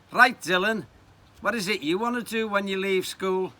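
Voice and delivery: high, loud careers officer; official questioning. Acted. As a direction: address the pupil briskly and officially.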